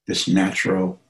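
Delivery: unhurried meditation guidance with long pauses; guided meditation. Acted. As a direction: guide listeners gently into relaxation, phrase by phrase.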